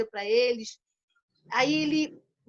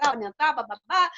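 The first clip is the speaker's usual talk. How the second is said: usual speaking voice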